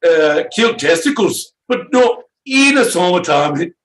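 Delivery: put-on high, strained failed Swiss accent; attempted Swiss accent. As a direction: push a fake Swiss voice way up. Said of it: "See, I can't do it".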